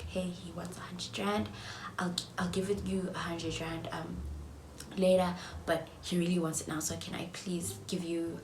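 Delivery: soft whisper asking nicely; hushed request he should have made; polite hushed request. Pretend. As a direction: ask gently, half whispered. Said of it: "and you're like".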